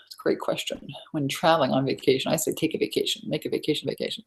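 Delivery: soft unvoiced whisper; whispered aside. Real, not acted. Coach wrapping up the vacation question, whispered. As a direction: drop to a whisper to wrap up.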